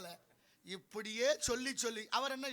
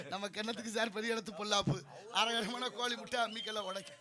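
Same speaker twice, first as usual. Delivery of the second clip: normal talking voice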